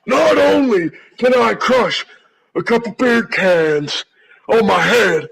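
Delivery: shouting wrestler promo rant; barbarian. Acted. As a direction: bellow it like a brawler.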